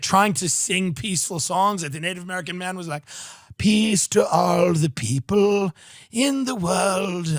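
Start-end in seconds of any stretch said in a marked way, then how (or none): 3.6-7.4 slow, put-on British accent; mock British accent. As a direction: preach peace in a hammed-up British voice. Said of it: "I don't know why he's British".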